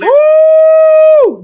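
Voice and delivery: very high, loud, long whoop; drawn-out high holler; excited whoop. Real, not acted. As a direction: let out a piercing, sustained "woo". Whoops over the played clip.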